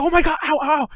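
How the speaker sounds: high-pitched yelps of pain, acted out; retold pained shout. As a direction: cry out as if your sore foot is pressed. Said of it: "I, I shout".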